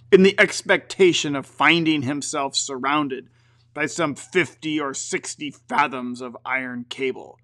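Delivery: usual speaking voice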